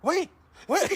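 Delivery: very high, loud imitation of pleading; mock panicked shout. Friend on toilet calls to departing prankster, mock panic. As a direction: yelp after someone leaving, hammed-up.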